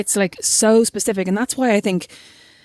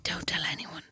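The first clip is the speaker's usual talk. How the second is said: secretive hushed whisper; 3 semitones lower, 15 dB quieter than usual. Retold or imitated. Secretly giving child chocolate.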